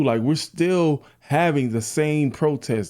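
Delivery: ordinary talk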